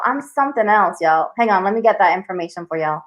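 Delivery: ordinary delivery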